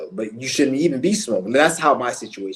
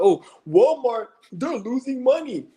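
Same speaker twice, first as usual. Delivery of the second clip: much higher, whiny mock voice; mocking dumb people; 12 semitones higher than usual. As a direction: parody them in a shrill-pitched sneer. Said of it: "dumbass people out here talking about".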